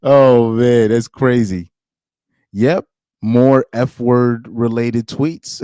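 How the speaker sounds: laughing through the words in disbelief. Real, not acted. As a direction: talk while cracking up. Reacting to outrageous tweets.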